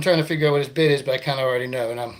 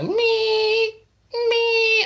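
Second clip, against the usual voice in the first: drive-through beep. Retold or imitated. Drive-through speaker beeping.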